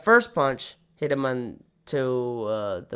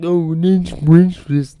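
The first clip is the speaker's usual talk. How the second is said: loud exaggerated mock protest; mocking audience voice; 10 dB louder than usual. Imitating the audience objecting, loud and mocking. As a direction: ham it up as a heckler. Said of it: "you're gonna be all like".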